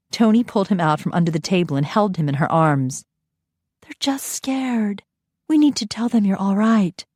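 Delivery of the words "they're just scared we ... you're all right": calm him gently; soothing. Acted. Nanny soothing the frightened boy.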